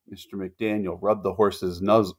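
ordinary delivery, nothing marked

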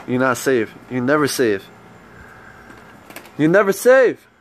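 ordinary talk

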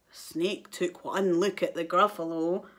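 plain reading voice

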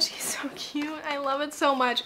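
ordinary talk

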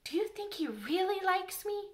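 high-pitched eager question; hopeful child's question, lifted high. A narrator's reading. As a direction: ask it longing for a yes. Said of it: "She did so want to know".

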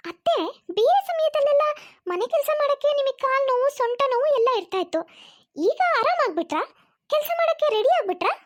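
sarcastic taunt. Acted. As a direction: needle her mother-in-law with sarcasm. Daughter-in-law taunts mother-in-law. (ವ್ಯಂಗ್ಯದ ಕುಟುಕು ಮಾತು)

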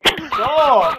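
high, loud sudden shout; shouted protest. Real, not acted. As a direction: yell it out to halt someone. Calling out during play.

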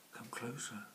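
soft whispered coaxing. Acted. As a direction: coax it nearer in a hush. Cat whispers.

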